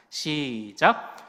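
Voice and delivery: long drawn-out start cue; reading cue. Real, not acted. Preacher cueing the congregation to read verse together, drawn out. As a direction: stretch it out to bring everyone in.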